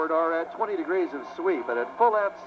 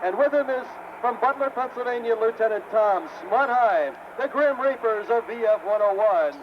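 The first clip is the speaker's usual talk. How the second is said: hyped announcer intro; 6 semitones higher than usual. Real, not acted. Announcer introducing crew and jet.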